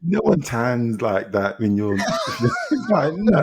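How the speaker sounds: laughing through the words in disbelief. Real, not acted. Telling a friend no one tans like that, laughing. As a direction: crack up while objecting.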